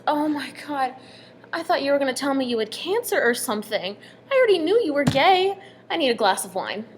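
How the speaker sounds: imitated overdramatic reaction; exasperated relief. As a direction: retell the friend's sister's dramatic, exasperated outburst. Sister's exasperated reaction to coming out.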